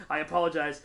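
usual speaking voice

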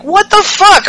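high stunned outburst; incredulous. Retold or imitated. Host reacting to Alexa never breaking character, stunned. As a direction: blurt it in disbelief. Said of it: "I was like".

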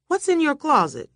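high, insistent question; urgent questioning. A narrator's reading. Questioning the terrified woman, urgent.

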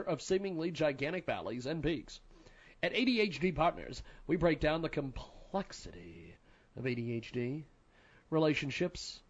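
soft, even sponsor read. Acted. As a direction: read the ad smoothly and quietly. Reads sponsor ad.

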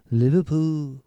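"Liverpool" in a fuller Liverpool accent. Shown as a demonstration. Trying again to say it like a Liverpudlian, in a Liverpool accent. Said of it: "That's better".

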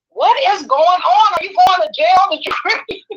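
high-pitched, rapid-rising demand; acted-out bewildered mother firing questions; alarmed disbelief. Mother seeing daughter's red eyes at court, alarmed.

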